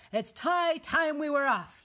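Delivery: loud, high, drawn-out call; pirate announcement. A narrator's reading. Pirate captain tells crew to leave, announcing loudly. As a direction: announce it slowly to the deck. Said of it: "he announced".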